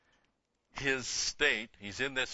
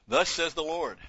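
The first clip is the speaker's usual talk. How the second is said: raised, ringing scripture reading, louder; proclaiming scripture; 7 semitones higher, 8 dB louder than usual. Acted. Bible teacher to class, raised reading voice. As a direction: proclaim the verse to the congregation.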